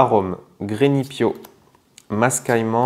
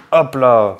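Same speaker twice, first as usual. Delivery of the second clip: ordinary talk, nothing marked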